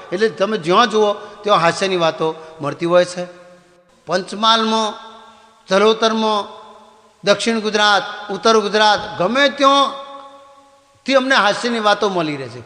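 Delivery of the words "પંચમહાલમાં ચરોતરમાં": usual speaking voice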